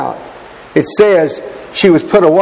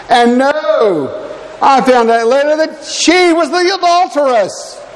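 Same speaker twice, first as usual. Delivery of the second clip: NO and SHE pitched high and drawn out; dramatic reveal; 9 semitones higher, slower than usual. Real, not acted. Preacher revealing she was the adulteress, high drawn-out emphasis. Revealing the twist of his story.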